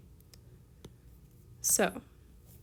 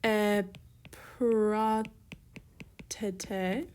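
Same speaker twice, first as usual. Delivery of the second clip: very slow "Epratete" with long gaps between syllables; much slower than usual. Shown as a demonstration. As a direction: dictate it piece by piece, as if writing along. Writing out the form slowly.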